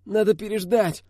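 high, strained moan; pained groaning. A narrator's reading. Wounded Aidar advises waiting, high groaning voice. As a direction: groan the words through pain. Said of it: "застонал Айдар, кривя лицо".

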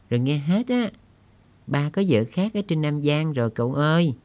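put-on high child voice, wistful and dreaming aloud; dreamy little girl. Girl tells family news.

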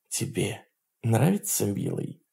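very soft sultry purr; femme fatale. Acted. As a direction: coo it like a temptress. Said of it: "промурлыкала она".

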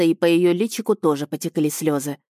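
usual speaking voice

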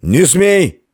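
put-on high, drunken warrior's yell. A narrator's reading. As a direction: bellow a furious warning. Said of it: "заорал Феодогат".

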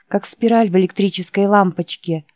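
plain reading voice

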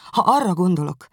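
high, loud, distraught. Acted. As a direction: voice rising in distress. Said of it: "még izgatottabb hangon". (még izgatottabb, magas, hangos hangon)

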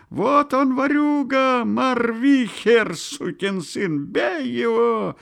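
high, drawn-out mob howl; high, slow furious wail. A narrator's reading. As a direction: howl the accusation over the crowd. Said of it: "кто-то взвыл в небесах".

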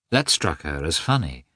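plain reading voice

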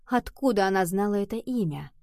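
ordinary delivery

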